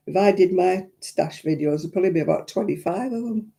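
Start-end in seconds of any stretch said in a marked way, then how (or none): none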